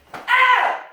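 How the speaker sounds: sharp, high martial arts yell; loud kiai. Shown as a demonstration. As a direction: bark the ki on the last punch. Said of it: "give a loud ki".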